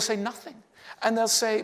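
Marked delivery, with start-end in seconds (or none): 0.2-0.6 mock sulk, higher and softer; sulky. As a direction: softly refuse to explain, acting hurt. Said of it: "and we'll sulk".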